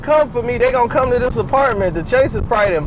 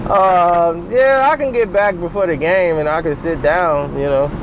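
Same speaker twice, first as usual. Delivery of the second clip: much lower, laid-back messenger voice; a much lower, mellow messenger; James Jones impression; 6 semitones lower than usual. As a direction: drop way down and play it easy. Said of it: "send James Jones".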